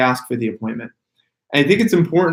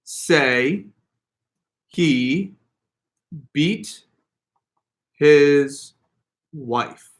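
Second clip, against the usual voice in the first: one word at a time with long pauses; slow, separated dictation; much slower than usual. Shown as a demonstration. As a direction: spell out the sentence word by word, a full pause after each. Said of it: "the words were".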